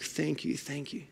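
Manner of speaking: fading softly towards a murmur; hushed repeated thanks. Retold or imitated. Grateful workers thanking the man who saved them, hushed and fading.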